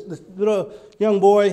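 ordinary talk

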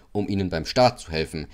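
plain reading voice

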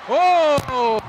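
loud, high surprised cry; surprised shout. Real, not acted. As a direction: gasp it out as the play happens. Sudden basket inside.

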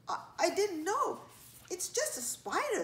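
high, hesitant little voice; flustered child. A narrator's reading. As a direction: protest innocence, stumbling at the start. Scolded child makes excuses.

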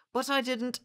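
put-on very high, loud boy voice; high-pitched objection from a scolded boy; protesting boy. A narrator's reading. Boy protests to his punisher.

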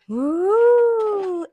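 loud high whoop of joy; excited cheer. Real, not acted. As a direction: let out a big fan whoop. Fan cheering at album reveal.

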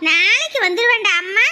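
normal talking voice

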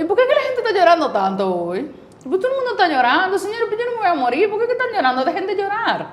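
high-pitched, incredulous retelling; exasperated reassurance. Retold or imitated. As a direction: playfully scold a crying class. Acting herself scolding her crying students.

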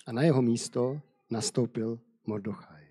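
usual speaking voice

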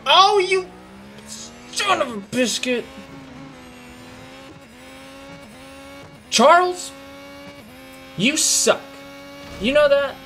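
high furious yell at a rival driver; angry yelling. Real, not acted. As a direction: scream at the car that ruined the run. Raging at rival car in race.

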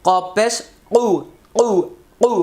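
কু from the middle of the throat; throat kaf ku. Shown as a demonstration. Teacher demoing কু from mid-throat for students. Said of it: "হলকের মধ্যখান থেকে কু".

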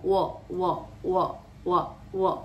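"wo" as a short rounded syllable; repeated "wo", isolated, no R; very closed A. Shown as a demonstration. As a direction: round the lips tightly on "wo". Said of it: "una A muy cerrada".

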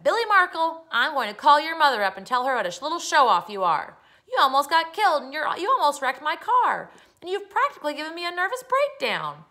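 high, loud angry scolding; very mad. Acted. Neighbor scolds boy who nearly hit her car, furious. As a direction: tear into the child at full voice. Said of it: "and was very mad".

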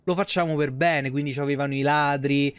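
ordinary talk, nothing marked

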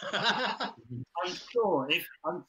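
laughing. Real, not acted. Laughing at request for recording.

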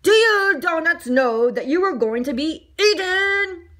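high, loud call across the room; yelled. A narrator's reading. Baker asks the other donuts, yelling. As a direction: call it out to a crowd. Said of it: "The baker yelled to the other donuts".

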